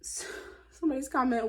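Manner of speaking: usual speaking voice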